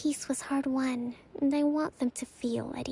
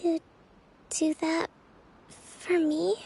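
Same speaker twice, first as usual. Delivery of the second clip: ordinary delivery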